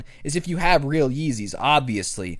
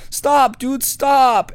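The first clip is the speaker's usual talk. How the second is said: high, far put-on voice, rising to a shout; crying kid yelling; 9 semitones higher, 3 dB louder, much slower than usual. Kid pleading with classmates, high crying shout. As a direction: yell stop like a kid breaking down.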